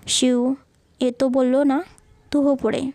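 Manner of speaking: ordinary delivery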